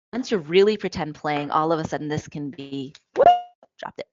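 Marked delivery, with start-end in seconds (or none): none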